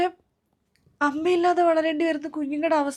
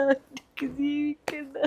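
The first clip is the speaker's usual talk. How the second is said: softer, slow, collapsing; broken repeat; 6 dB quieter, slower than usual. Acted. Mother repeating she can't go on, softly broken. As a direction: let the repeat fall apart.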